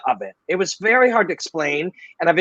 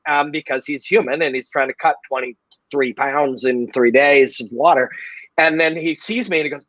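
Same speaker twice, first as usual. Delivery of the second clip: usual speaking voice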